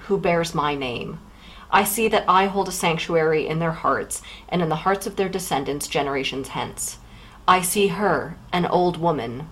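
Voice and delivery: a hint of a grave dramatic reading; solemn reading aloud. Reads Carton's last thoughts aloud.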